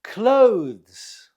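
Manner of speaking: "Clothes" as a single loud, falling syllable; one syllable. Shown as a demonstration. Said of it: "It's one syllable".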